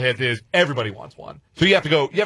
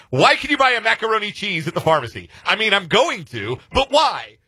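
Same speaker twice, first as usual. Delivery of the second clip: put-on New Zealand accent, pitched higher; puzzled Kiwi tourist in a New Zealand accent, pitched up; lightly imitated New Zealand accent, higher; 8 semitones higher than usual. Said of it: "he says in his New Zealand accent".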